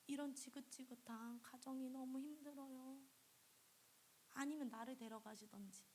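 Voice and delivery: quietly begging God through mild tears; tearful prayer. Retold or imitated. Girl in her room softly crying out to God, tearful. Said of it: "울면서 기도했대요".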